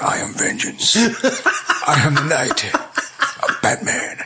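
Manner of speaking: whispered, gravelly-free husky Batman impression with a slow middle; Batman growl. Quoting Batman to his friend in a husky whisper. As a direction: rasp it out like the Dark Knight. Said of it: "It's like".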